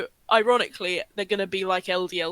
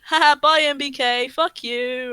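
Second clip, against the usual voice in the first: mock taunt; 5 semitones higher, 6 dB louder than usual. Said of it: "they went".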